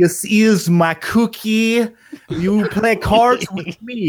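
high, slow exaggerated Russian gangster; hammed-up slow Russian villain accent; Malkovich Russian accent. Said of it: "Malkovich from Rounders".

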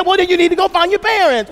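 high, loud dad comeback; imitated dad yelling. Dad insisting his son find his parents, yelling. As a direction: drive the point home in a mock holler. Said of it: "he starts yelling at us".

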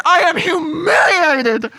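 slow, high, loud wail of humiliation; acted humiliated cry. Jilted bride crying out her humiliation to the groom. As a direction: sob it out loud and slow, hammed-up. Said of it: "Honestly, like, pretty good acting".

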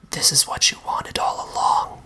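whispered, menacing monster voice; hushed and flat. A narrator's reading. Mister Monster answers the narrator blocked from leaving, hushed whisper. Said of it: "His voice was always hushed and flat".

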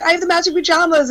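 high, quick gushing; excited retelling. Retold or imitated. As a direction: rush it out high, thrilled by the match. Trading thrilled discoveries, retold.

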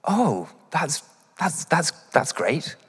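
feigned polite interest; polite fake enthusiasm. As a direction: hide confusion behind a polite high "that's great". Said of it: "what they say is".

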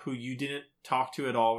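usual speaking voice

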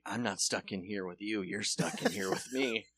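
low, quiet, lightly menacing; low, quiet threat; menacing movie quote. Retold or imitated. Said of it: "he go-, like".

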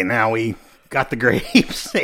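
ordinary delivery

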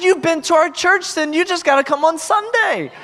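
high, buoyant urging; excited invitation. Retold or imitated. Pastor urging two girls to come on Sunday, high and excited.

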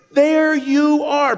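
high, slow, loving There you are; drawn out like a delighted father; God's welcoming voice. Retold or imitated. God greeting his child arriving home, slow and joyful. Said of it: "hear his voice saying".

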